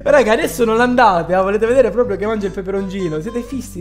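ordinary talk, nothing marked

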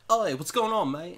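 lightly voiced English band guy greeting; a hint of an English accent. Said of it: "they're from England".

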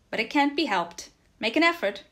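shouting encouragement. A narrator's reading. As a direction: shout to coax a tired horse onward. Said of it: "he shouted".

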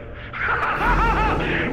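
high burst of laughter. Real, not acted.

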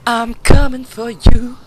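high, loud and slow threat; loud taunt. Real, not acted. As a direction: bellow it slowly at the enemy. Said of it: "repeated after plain take".

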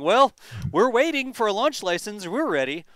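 hammed-up impatient Elon on the launch pad. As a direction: play it like a boss tapping his watch. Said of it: "is Elon gonna be out there ... saying".

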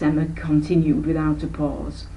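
ordinary delivery, nothing marked

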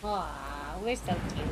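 cooing aw. Real, not acted. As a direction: melt over something adorable. Cooing at the cats.

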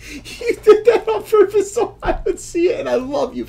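high voice cracking up with laughter; words squeezed out through giggles; laughing while talking. Real, not acted. Laughing at the viewer who set up the card.

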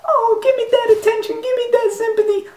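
high whiny mocking plea; mocking attention seeker. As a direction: whine for attention like a spoiled kid. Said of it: "If you sitting there like".

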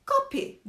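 "Kapi" with an o sound, like kopi; saying kapi. Retold or imitated.

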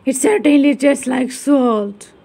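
loud, grudging monster voice; doubtful ghoul. Acted. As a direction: concede it with suspicion. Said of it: "said the ghoul doubtfully".

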